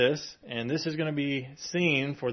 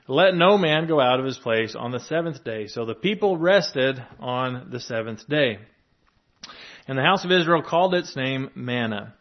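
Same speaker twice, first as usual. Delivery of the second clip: scripture reading; 4 dB louder than usual. Acted. Reads scripture passage aloud.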